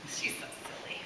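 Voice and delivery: hushed whisper. Real, not acted. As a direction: breathe it gently. Whispering to child.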